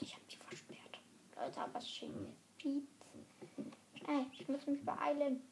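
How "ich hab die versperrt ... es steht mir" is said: sly whispered confession; secretive whisper. Real, not acted. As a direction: whisper it with glee.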